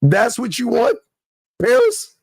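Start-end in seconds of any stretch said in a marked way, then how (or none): 1.6-2.1 squeaky mock plea. Mocking sucker's plea.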